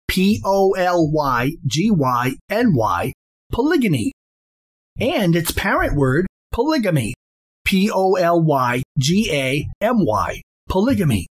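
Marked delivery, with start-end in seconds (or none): 0.1-3.1 slow, letter-by-letter spelling; spelled out. Shown as a demonstration. Lecturer spelling polygyny for listeners, slow and letter by letter. 7.6-10.4 slow, letter-by-letter spelling; spelled out. Shown as a demonstration. Lecturer spelling the parent word polygamy, slow and letter by letter.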